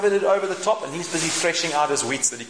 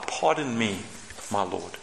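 low, much softer murmur; sheepish reply; 7 semitones lower, 6 dB quieter than usual. Acted. Believers answering God, low and sheepish. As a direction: mumble it, unconvinced.

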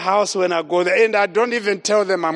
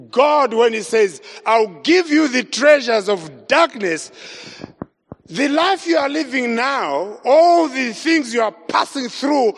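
full-force preaching; 3 semitones higher than usual. Acted. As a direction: preach it out, calling the church to answer. Preacher driving home the message.

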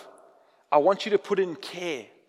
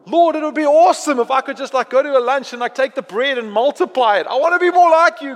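put-on louder, higher, gushing like a kid asking for superpowers; eager mock prayer; 5 semitones higher, 7 dB louder than usual. Preacher mimics a believer's showy prayer, eager and loud. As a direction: ham up a greedy wish to God. Mocking a self-serving prayer.

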